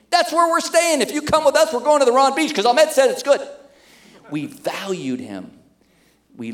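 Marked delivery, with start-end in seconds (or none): 0.1-3.4 much higher and louder, proclaiming it; excited announcement. Retold or imitated. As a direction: announce it to the whole group. Group announcing the decision.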